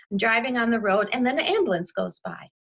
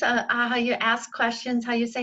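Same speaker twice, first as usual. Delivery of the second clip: ordinary talk, nothing marked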